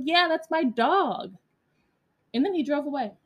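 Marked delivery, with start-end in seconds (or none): 0.0-1.3 higher, drawn-out retold comeback; indignant retort. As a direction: stretch it out pointedly at the driver. Retold confrontation with the driver.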